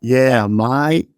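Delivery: mimicked Aussie, vowels stretched; playful drawn-out Australian attempt; imitated Australian drawl. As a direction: copy the long-held Australian syllables. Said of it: "let 'em hang on for ages".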